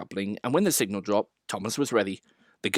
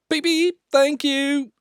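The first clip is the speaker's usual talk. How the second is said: high, bright beeps like a little engine whistle; whistling Thomas; 5 dB louder, slower than usual. A narrator's reading. Little engine whistling thanks to waving children. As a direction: toot it out as a cheerful engine. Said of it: "and he whistled".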